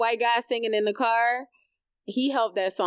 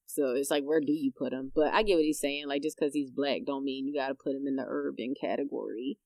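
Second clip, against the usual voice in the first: ordinary delivery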